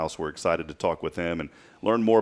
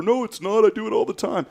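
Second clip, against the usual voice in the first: high, raised mock-wife voice; imitating dismissive wife; 5 dB louder than usual. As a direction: brush off the panic in her high voice. Acts out Bridget brushing off panic.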